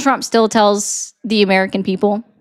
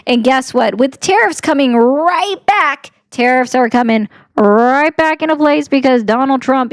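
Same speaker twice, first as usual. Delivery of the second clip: ordinary delivery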